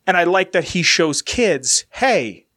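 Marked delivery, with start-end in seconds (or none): none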